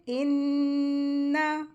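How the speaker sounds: इन्ना with a held, nasal ghunna on the doubled nun, no jerk; Quranic recitation. Shown as a demonstration. Tajweed teacher shows students how to recite इन्ना. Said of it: "तो कैसे पढ़ेंगे?".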